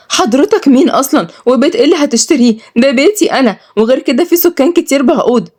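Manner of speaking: put-on high-pitched indignant young woman; angry. Malak challenges the buyer of her house, angry. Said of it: "واتعصبت ملك".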